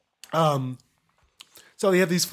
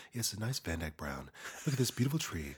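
mock Bob Ross; 6 semitones lower, 11 dB quieter than usual. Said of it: "every time you said colors".

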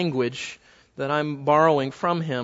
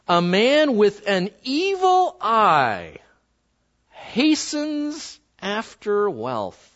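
dramatic scripture reading; much slower than usual. Acted. Pastor reading Proverbs to the congregation, very slow and grave. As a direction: read it like a solemn warning. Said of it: "dramatic scripture reading".

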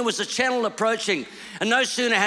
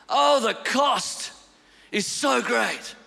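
slow, heavy; 3 semitones higher, much slower than usual. Acted.

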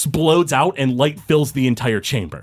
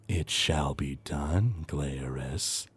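much lower, softer and slow; ghostly entity; 9 semitones lower, 12 dB quieter, much slower than usual. Acted. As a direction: seal the pact, deep and eerie. Entity accepts deal.

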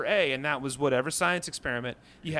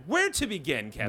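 raised, swooping pitch; theatrical question. Real, not acted. Host asks co-host where to begin, theatrical raised voice. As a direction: put the question grandly to your co-host.

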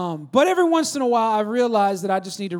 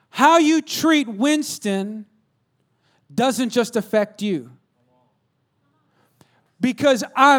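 slow, weighty, raised pronouncement; deliberate dad speech with long pauses; stern fatherly warning; 4 semitones higher, 5 dB louder, much slower than usual. Retold or imitated. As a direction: lay down the law word by word. Father lays down law to son.

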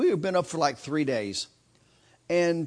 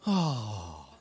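deflated groan; 10 semitones lower, 7 dB quieter than usual. Retold or imitated. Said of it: "you heard everybody go".

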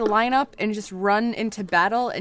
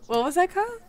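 put-on higher-pitched try at an Australian accent; playful mock Australian lilt; attempted Australian accent; 9 semitones higher, 4 dB quieter, faster than usual. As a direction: ask it in a put-on Aussie accent. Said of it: "both trying to do Australian accents".

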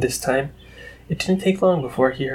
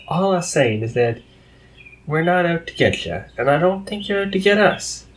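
a hint of a higher, folksy country mare; gently voiced earnest pony; lightly voiced Applejack; 6 semitones higher than usual. A narrator's reading.

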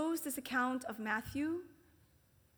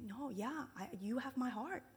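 soft devotion; 9 dB quieter than usual. Retold or imitated. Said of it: "basically saying".

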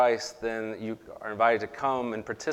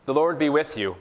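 call and response; 5 semitones higher, 6 dB louder than usual. Real, not acted. Liturgical call to congregation.

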